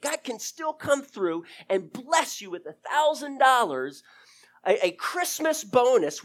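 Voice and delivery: rising pulpit proclamation; fervent preaching. Acted. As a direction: preach it out to the church. Preacher builds to full force.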